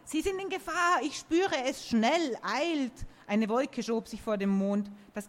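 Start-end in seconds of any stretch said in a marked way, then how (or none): 0.0-3.1 high, urgent warning; alarmed talking tree. Acted. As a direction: warn them to hurry. Oak alarmed, in a story.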